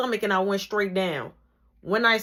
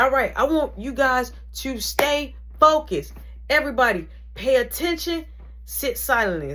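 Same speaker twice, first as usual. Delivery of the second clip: instructor's command; 6 semitones higher than usual. Retold or imitated. Instructor voiced giving orders.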